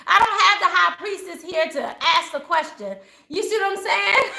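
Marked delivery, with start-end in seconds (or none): none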